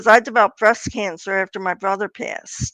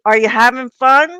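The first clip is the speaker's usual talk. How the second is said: stranger's voice; 2 semitones higher, 6 dB louder than usual. Retold or imitated.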